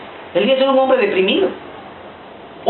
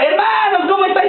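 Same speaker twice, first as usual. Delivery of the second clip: much higher friendly greeting; cheerful Paul; 11 semitones higher than usual. Retold or imitated. Paul greeting scared Christians, much higher cheery voice. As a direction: greet them brightly and warmly.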